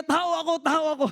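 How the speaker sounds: lightly acted, high-pitched plea; mock protest from inside a costume; unmasked lion's plea. Preacher voices the lion revealing he is human, mildly pleading. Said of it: "sabi niya".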